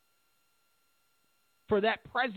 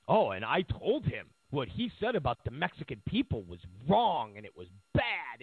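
much lower, dismissive imitation of Trump; mock Trump quote; 10 semitones lower than usual. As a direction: drop the voice low and brush it off. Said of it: "and said".

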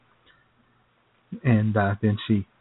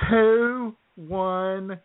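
very slow, higher, each number drawn out; slow typing out; 7 semitones higher, much slower than usual. Retold or imitated. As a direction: read the numbers like slowly typing them. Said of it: "She goes".

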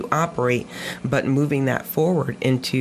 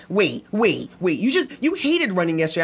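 imitating puzzled friends, pitched up; retold baffled protest; 5 semitones higher than usual. Friends reacting to Facebook post, mock baffled protest. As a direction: halt them, then hurry into disbelief.

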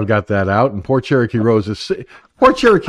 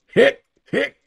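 high squeaky hiccups; hiccup sounds; 13 semitones higher than usual. Retold or imitated. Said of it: "I went".

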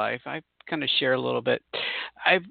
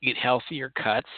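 ordinary talk

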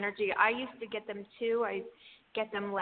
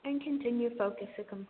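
airy breathy mystical healer. Acted. Guides an energy healing session.

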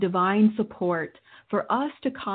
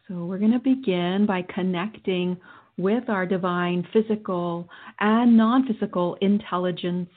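guided meditation. Acted. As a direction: guide them through calmly.

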